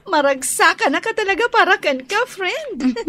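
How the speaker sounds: laughing, fast and excited; giggly. Acted. Friend laughing happily over the news. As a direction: gush through giggles.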